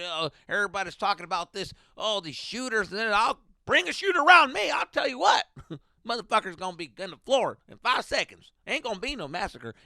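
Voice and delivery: loud, high mock bragging; hammed-up cowboy boast; boasting driver. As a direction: play the cocky driver bragging.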